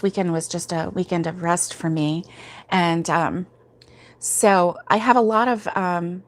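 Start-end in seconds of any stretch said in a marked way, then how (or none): none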